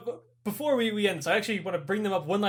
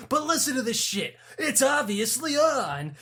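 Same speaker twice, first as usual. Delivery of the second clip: mock insistent officer; 6 semitones higher than usual.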